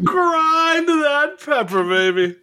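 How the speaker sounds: high, slow, laughing wail of the line; laughing high repeat. Acted. Friend echoes the joke line, laughing high and slow. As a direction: cry it out through laughter, stretched long.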